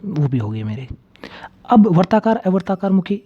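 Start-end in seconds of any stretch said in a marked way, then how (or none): 0.0-0.9 sudden whisper. Real, not acted. Teacher lists places, drops voice.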